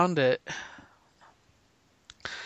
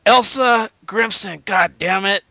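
raised, higher-pitched cussing general; hammed-up foul-mouthed officer; profane general; 5 semitones higher, 4 dB louder than usual. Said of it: "profane and vulgar".

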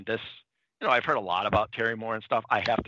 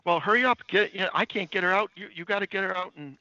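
higher-pitched acted urgency; urgent retold plea; 9 semitones higher than usual. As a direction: imitate someone urging a helper along. Retold urgent argument over rescuing friend.

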